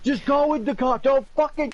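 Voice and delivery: hammed-up high-pitched protest; mock screaming. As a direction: scream as if being shoved into a car. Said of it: "It's him screaming".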